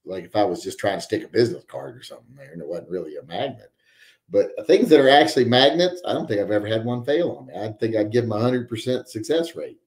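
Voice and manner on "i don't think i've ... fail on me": normal talking voice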